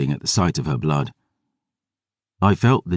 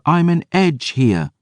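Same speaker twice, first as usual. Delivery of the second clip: put-on insistent girl; 11 semitones higher than usual. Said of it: "she insisted".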